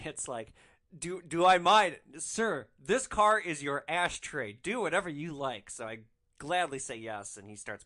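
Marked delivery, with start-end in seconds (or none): 1.0-5.7 loud, slow, over-the-top eager yes; eager loud reply. Retold or imitated. Driver eagerly letting the passenger smoke, loud and slow. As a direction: grovel enthusiastically to the passenger.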